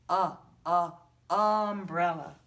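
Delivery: teacher sounding out the short u; stretched "uh". Shown as a demonstration. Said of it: "teacher stressing the U sound".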